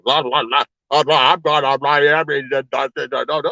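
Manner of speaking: mock thick-tongued garble; slow-tongued mumble. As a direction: imitate a heavy tongue tripping over syllables. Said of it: "His tongue sound like a damn sloth".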